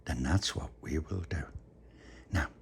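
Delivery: soft, hushed goodnight voice; bedtime whisper. Real, not acted. Bedtime soft talk to child.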